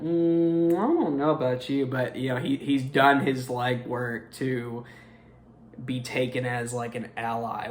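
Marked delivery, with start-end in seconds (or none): 0.0-1.9 mock suspicion, sizing someone up; skeptical side-eye. Movie characters doubting the uncle, mock wary side-eye. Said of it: "kinda giving the side eye".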